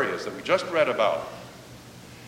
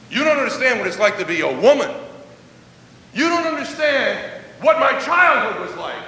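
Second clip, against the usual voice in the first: rising defensive complaint, growing more upset; an imagined woman pushing Jesus away, getting louder as she goes; building protest; 4 semitones higher, 6 dB louder than usual. Pretend.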